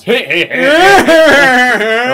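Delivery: booming high hearty Santa chuckle; Santa laugh. Retold or imitated. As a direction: belt out a hammed-up Santa laugh.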